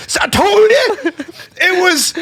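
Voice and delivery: very high-pitched crowing, laughter breaking in; imitated vindication. Coworker proving his point in front of customers, triumphant.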